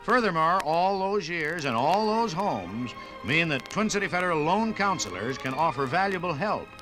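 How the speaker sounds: ordinary delivery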